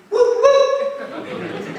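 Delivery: high, loud "whoop, whoop" like a whistle; train whistle whoop. Acted. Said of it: "call out as one".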